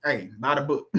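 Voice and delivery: ordinary talk, nothing marked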